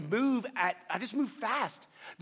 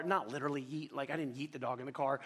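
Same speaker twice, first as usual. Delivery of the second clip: softer, quicker throwaway aside; quiet aside; 6 semitones lower, 7 dB quieter, faster than usual. Real, not acted. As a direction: drop it under your breath as a joke. Quick aside, joking correction.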